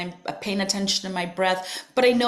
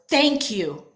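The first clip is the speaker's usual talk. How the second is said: slightly raised retold thank-you; mildly emphatic quoted thanks; loud, audible thanks. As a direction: recall saying it out loud, gratefully. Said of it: "I utter this loud, audible".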